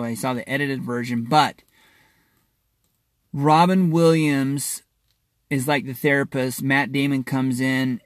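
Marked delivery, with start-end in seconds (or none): none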